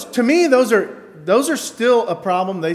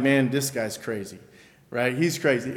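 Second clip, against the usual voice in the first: softer, dismissive murmur; quiet aside; 6 semitones lower, 6 dB quieter than usual. Retold or imitated. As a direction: mutter it under your breath. Dismissing a false teacher.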